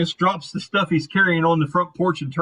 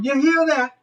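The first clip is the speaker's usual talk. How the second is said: high, raised scolding call; needling yell in a higher woman's voice; yelling over shoulder; 7 semitones higher than usual. Acted. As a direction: holler a jab at someone out on the porch. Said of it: "She yells over her shoulder".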